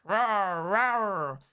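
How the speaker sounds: playful growling roar; mock roar. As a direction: roar to scare the beekeeper. Barry mimics the bear.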